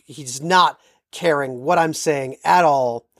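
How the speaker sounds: ordinary talk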